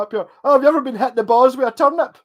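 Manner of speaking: put-on high and loud, a cheeky kid's punchline; wee boy caller. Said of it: "He's like".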